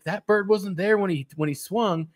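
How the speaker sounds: high mock disbelief; disbelieving commentator. Friend retelling what the golf skit's announcer said, mock disbelief. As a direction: imitate a baffled golf commentator. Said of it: "like the whole commentary".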